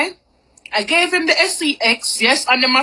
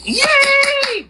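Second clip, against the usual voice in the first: sudden high excited yay; excited cheer; 13 semitones higher than usual. Retold or imitated. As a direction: squeal it like you've won. Cheering at his promise.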